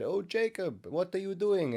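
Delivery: high-pitched mock Russian accent. Girlfriend catching him praying aloud in bed, Russian accent. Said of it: "in a Russian accent".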